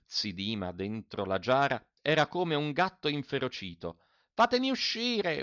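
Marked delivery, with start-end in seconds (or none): 4.3-5.4 high furious yell from inside the jar. A narrator's reading. Trapped mender yells at everyone to free him, high yell. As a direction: scream to be let out.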